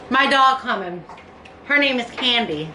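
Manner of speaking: usual speaking voice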